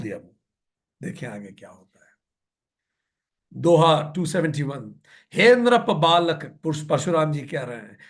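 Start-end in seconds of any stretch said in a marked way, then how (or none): 5.3-6.6 slow, measured verse chanting; verse recitation. A narrator's reading. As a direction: recite the scripture line with drawn-out syllables. (धीमा दोहा पाठ)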